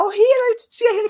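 put-on very high, sweet little-kid call; child's voice. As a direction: call auntie like a small child. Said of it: "E se vier falar". (voz de criança chamando a tia, bem aguda)

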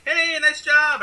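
cheery parent praise. Retold or imitated. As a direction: cheer the kid on so he won't cry. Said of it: "they would just be like".

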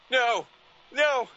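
high, loud panicked cries of no; terrified screams. A narrator's reading. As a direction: scream it in pure horror. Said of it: "he screamed".

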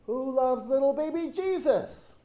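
high, loud mock shout of pageant kids; imitating children's shouted chant; shouted question. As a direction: call it across the aisle, pitched right up. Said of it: "they would shout".

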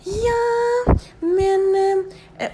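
high, loud hesitant receptionist; mocking receptionist voice. Receptionist stalling to the patient, high mock voice.

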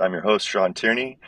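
usual speaking voice

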